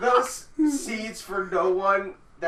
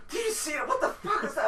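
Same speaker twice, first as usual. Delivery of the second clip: mock freak-out; 10 semitones higher, 4 dB quieter than usual. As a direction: imitate a friend panicking in a high voice. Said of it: "he starts freaking the fuck out".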